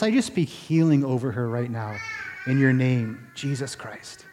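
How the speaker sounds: soft, low retold prayer; simple prayer. Man praying for healing over a sick woman, simple hushed prayer. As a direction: pray it gently and plainly, hushed. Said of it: "a real simple little prayer".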